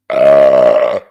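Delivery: deep, loud real burp; loud belch. Real, not acted. Drinking streamer belches on air before apologizing. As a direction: let out a big belch mid-sentence. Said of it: "sorry for all the belches".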